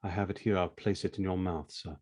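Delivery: servile butler. Acted.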